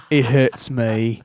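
put-on slow Cockney British accent; Michael Caine impression. Imitating a British actor in pain, slow Cockney accent. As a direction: drawl it out in mock Cockney. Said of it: "Michael Caine would be like".

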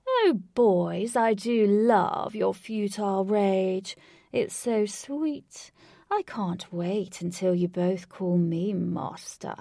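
slow, booming villain gloating. A narrator's reading. Villain taunting two foes from sixty meters away, booming. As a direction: boom it across a field, savouring each word. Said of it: "Lutz boomed from over sixty meters away".

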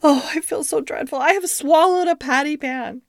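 high, plaintive storybook whine; moaning and whining. A narrator's reading. Duchess feeling dreadful about the patty pan, moaning. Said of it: "Duchess moaned and whined".

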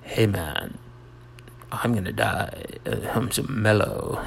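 laid-back stoner-style delivery of dire news; mock mellow. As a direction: play it totally chilled, with gaps between phrases. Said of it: "melodramatic is like".

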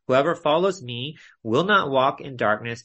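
usual speaking voice

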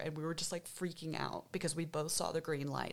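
ordinary talk

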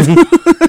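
short, high burst of laughter; real laugh. Real, not acted. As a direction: laugh out loud at the memory. Laughing at her own story.

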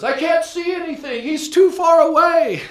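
high, loud cry that he can't see; despairing lament. Acted. Preacher voicing cartoon man, high despairing cry. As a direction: wail it high, as if lost. Cartoon man's next slide thought.

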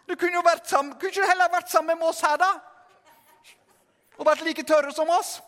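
high, reproachful nagging; mock scolding. Brothers reproach sinking Peter for leaving the boat, mock nagging.

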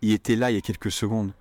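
il était là il y a quelques secondes slurred quick; L of il dropped; quick everyday French; fast natural version. Shown as a demonstration. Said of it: "Try this out".

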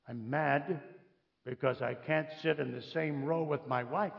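soft, understated confession of a petty grievance; quiet admission. Acted. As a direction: admit it softly. Quiet admission after mock outrage.

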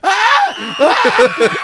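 high, loud acted screams; screaming child. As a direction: scream like a hurt kid on the ground. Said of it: "screaming on the ground".

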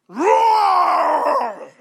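long high shouted roar; lion roar. Acted. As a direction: roar like a lion stalking its prey. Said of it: "I am now stalking prey".

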